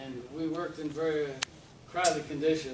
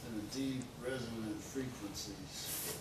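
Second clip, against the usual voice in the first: slow, lower and softer, mock-rich tones; mock-mellow announcer voice; mellow deep voice; 3 semitones lower, 7 dB quieter than usual. Shown as a demonstration. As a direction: put on a rich radio voice, slowly. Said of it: "Note the mellow tones".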